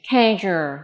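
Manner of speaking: "casual" with a buzzing zh in the middle; zh sound. Shown as a demonstration. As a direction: model "casual" carefully, voiced zh for the s. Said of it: "âm g".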